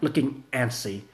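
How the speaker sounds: ordinary delivery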